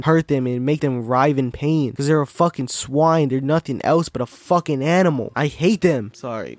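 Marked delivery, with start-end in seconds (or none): 6.1-6.6 quiet, lower apology after an outburst; soft, deflated sorry; subdued apology. Real, not acted. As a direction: drop the volume sharply to apologise.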